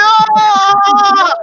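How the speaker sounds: very high, loud mock scream; high-pitched scream. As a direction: re-enact a wimpy, shrieking scream. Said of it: "I was like a little bitch".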